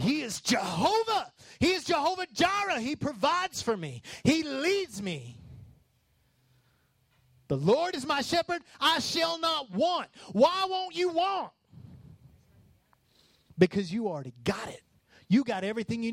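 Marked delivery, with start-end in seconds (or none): 0.0-5.4 building preacher shout. Real, not acted. 7.5-10.1 preacher's triumphant shout; shouted proclamation. Real, not acted. Preacher proclaims psalm at full force. 10.3-11.5 high rhetorical call. Real, not acted. Rhetorical challenge to congregation.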